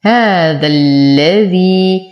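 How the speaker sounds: very slow "هذا الذي", short ذا into a long doubled lam; تشديد اللام. Shown as a demonstration. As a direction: recite "هذا الذي" slowly, alif dropped, leaning on the lam. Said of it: "فأنتقل من فتحة الذال إلى تشديد اللام". ("هذا الذي" ببطء شديد مع تشديد اللام وإسقاط الألف)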